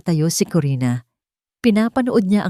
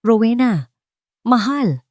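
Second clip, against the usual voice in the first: frantic shout; 5 semitones higher than usual. A narrator's reading. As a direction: call out to her in panic. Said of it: "sigaw niya". (asawang sumisigaw sa nabubuwal na asawa)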